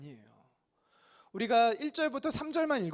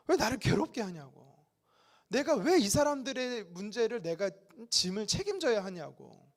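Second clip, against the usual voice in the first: ordinary talk, nothing marked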